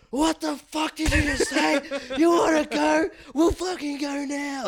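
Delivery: high, slow, raging dare to fight; furious retold challenge. Cook squaring up to the bullying chef, high furious challenge. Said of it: "I stared at him and I go".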